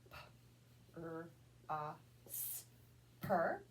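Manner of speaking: P, R, A, S each said alone, then "per"; sounded out sound by sound. Shown as a demonstration. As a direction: prosper broken into separate sounds. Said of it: "So the word is".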